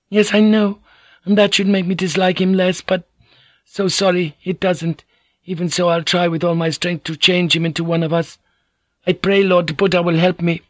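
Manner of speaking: dismissive samurai wife speaking sharply; Fujiko's voice. Acted.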